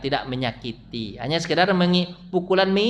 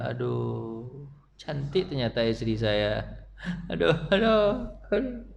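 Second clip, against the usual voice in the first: mock lament; 3 semitones lower, 7 dB quieter, slower than usual. Regretful husband sighing.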